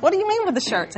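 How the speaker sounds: high, incredulous mock question; mock baffled. Mother feigning ignorance to her son on the phone, mock baffled. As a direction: play dumb about the shirts, pitch way up. Mom playing dumb on phone.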